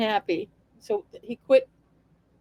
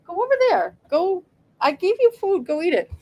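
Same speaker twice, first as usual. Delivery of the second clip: high-pitched plea to the cat; real, high, exasperated shooing; exasperated pet scolding; 7 semitones higher than usual. Real, not acted. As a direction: shoo the cat off in a raised pitch. Shooing the cat away while reading.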